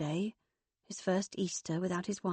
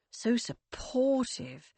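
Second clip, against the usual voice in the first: loaded sing-song; 5 semitones higher, much slower than usual. Acted. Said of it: "Lorelei's sing-song tones".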